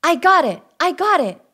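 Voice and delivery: high, loud and eager; excited pup. Acted. Little Wolf eager to show his father, excited. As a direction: bounce with eagerness like a young pup.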